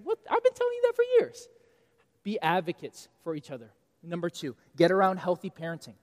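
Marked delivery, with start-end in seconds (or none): 0.0-1.5 high, loud mock outrage from a parent; exasperated parent. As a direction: play the fed-up parent who said it for years.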